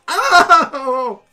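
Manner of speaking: high, loud howl of pain; yelp of pain. Acted. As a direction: cry out as if just stabbed. GM cries out for stabbed halfling.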